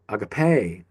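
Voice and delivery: "agape" as ah-ga-PAY; "agape" with the accent pushed to the end; stress on last syllable. Shown as a demonstration. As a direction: slowly, land hard on the final syllable of "agape". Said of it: "it feels different if you say".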